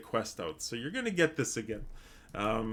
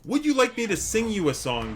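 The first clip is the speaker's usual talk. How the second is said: usual speaking voice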